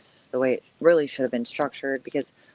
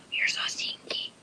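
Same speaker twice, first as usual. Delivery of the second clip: whispering; 8 dB quieter than usual. Real, not acted. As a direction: whisper it to the pet.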